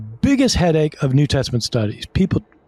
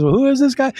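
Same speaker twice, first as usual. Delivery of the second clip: much higher, comically baffled question; puzzled John the Baptist; 12 semitones higher, faster than usual. Acted. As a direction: play a bewildered onlooker asking.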